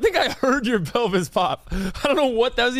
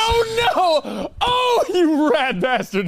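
mock outrage; 7 semitones higher, 4 dB louder, slower than usual. Real, not acted. Caught out, laughing protest.

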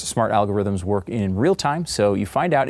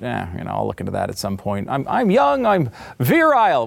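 mock bravado; 2 semitones higher than usual. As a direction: play the overconfident guy brushing it off, hammed up. Voicing a careless young man.

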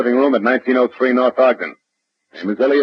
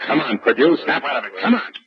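ordinary talk

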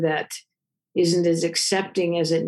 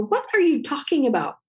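higher, incredulous question; retold disbelief; 8 semitones higher than usual. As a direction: act puzzled, as if caught off guard.

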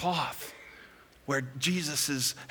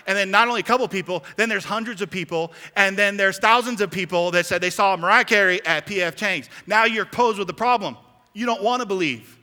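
higher, insistent preacher voice; building preaching; 4 semitones higher, 5 dB louder than usual. Real, not acted. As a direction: push it out emphatically, piling it up.

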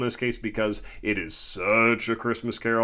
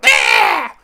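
sudden high shrieking "Ah!" much louder; a shrill jump-scare shriek; toy monster screech; 12 dB louder than usual. Retold or imitated. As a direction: let out a piercing monster scream. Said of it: "toys in there that go".

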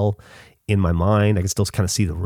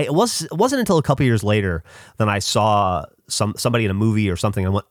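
normal talking voice

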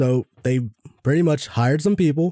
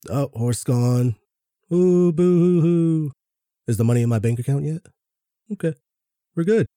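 fake crying; 2 semitones higher than usual. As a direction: sarcastically fake mourning the horse. Mocking shareholders' fake grief.

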